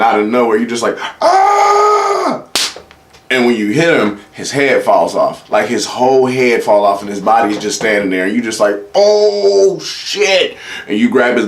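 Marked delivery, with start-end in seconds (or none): 1.2-2.4 sudden piercing high yell; a shrieking war cry as the punch lands; battle scream. Retold or imitated. Superhero punch in a fight. 8.9-10.6 horrified gasp of disbelief; shocked oh shit. Retold or imitated. Knocked someone's head off.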